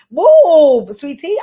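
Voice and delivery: loud, high-pitched "move it" yell; mock impatient shout. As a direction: yell it high, stretching the first word. Shouting someone out of the way.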